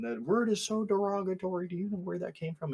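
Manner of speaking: high-pitched squealed imitation of outrage; mock blow-up. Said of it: "blew up at me again".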